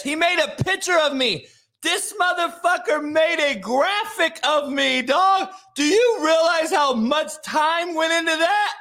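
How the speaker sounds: high-pitched, loud rant in disbelief; real incredulous outburst; incredulous shouting. Real, not acted. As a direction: shout it, voice way up, amazed at the effort. Outraged rant over a mocking graphic.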